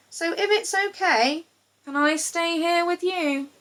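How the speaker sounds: high, hopeful pleading croc; a lonely croc asking shyly; lonely crocodile. A narrator's reading. Lonely croc asks to stay.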